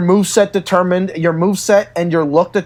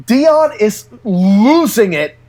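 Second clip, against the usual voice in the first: ordinary talk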